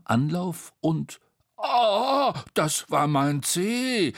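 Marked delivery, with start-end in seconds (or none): 1.6-4.1 loud, drawn-out yelp of complaint; pained cry. A narrator's reading. Tete after Bo steps on the toe, pained yell. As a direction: cry out over a trodden toe, pitched up. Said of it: "schrie Tete und hüpfte umher".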